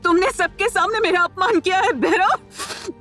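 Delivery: high-pitched crying, broken by halts; tearful, hurt accusation. Acted. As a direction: accuse him through sobs, voice climbing. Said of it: "उसकी आंखों में मोटे-मोटे आंसू". (रोते हुए, आहत ऊँची आवाज)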